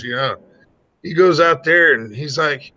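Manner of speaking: ordinary talk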